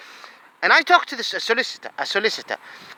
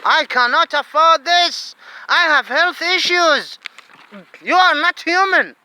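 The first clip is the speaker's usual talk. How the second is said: high, slow, pained protest; pleading anger at the officials; upset outburst; 8 semitones higher, slower than usual. Real, not acted. Distressed man protesting detention, high and pained. Angry rant at authorities.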